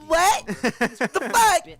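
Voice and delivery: very high, loud disbelieving cry; shocked outburst. Retold or imitated. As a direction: squeal out your disbelief. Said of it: "I'm like".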